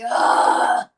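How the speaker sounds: breathy, unvoiced groan of disgust; disgusted ugh. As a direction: let out a fed-up ugh on breath alone. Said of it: "and you're like".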